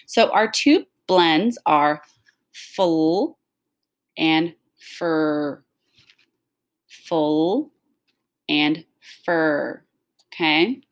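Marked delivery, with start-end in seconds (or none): 2.5-3.4 drawn-out sounds for a blend; slow and smooth. Shown as a demonstration. Teacher models a blend for the class, slow and smooth. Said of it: "say it slow and smooth". 6.9-7.7 drawn-out sounds for a blend; slow and smooth. Shown as a demonstration. Teacher models a blend for the class, slow and smooth. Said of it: "say it slow and smooth".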